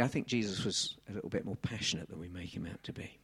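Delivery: usual speaking voice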